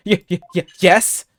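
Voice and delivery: startled stuttering reply; nervous stammer. Acted. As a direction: stutter it out, caught off guard.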